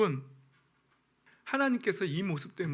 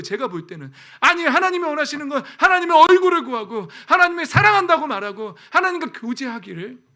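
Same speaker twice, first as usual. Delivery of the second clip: much higher, much louder denunciation; fiery preaching; 9 semitones higher, 11 dB louder than usual. Acted. Pastor denouncing Korean church's idolatry, fiery preaching. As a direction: rail against prosperity faith at full force.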